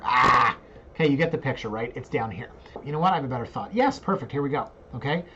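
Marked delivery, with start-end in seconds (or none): 0.0-0.6 short loud mock scream; loud "ah" of hammed-up frustration; frustrated yell. Host stuck on the street view, mock frustrated yell.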